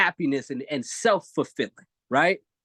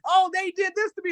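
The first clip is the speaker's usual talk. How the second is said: put-on whiny child. Child telling on someone to parent.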